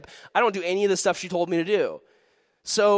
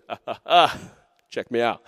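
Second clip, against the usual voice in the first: low, chuckling mock boast; hammed-up easy swagger; playful show-off; 5 semitones lower than usual. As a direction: show off with little effort grunts and chuckles. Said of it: "he lifts up that boulder and he goes".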